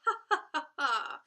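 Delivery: loud, high staged ha-ha-ha; high, loud performed laughter; mock laughing. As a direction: imitate a big song laugh. Imitates the song's laughing.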